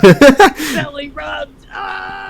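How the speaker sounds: drawn-out, loud mock moan of pleasure; mock ecstatic shout. Mocking the character's belly rub, mock ecstatic cry. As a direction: ham up the belly rub bliss.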